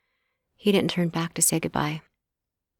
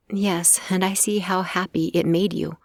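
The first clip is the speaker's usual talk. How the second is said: ordinary delivery, nothing marked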